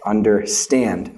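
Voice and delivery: un-der-STAND, weight on "stand" in "Understand"; correct final-syllable stress. Shown as a demonstration. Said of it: "It's understand".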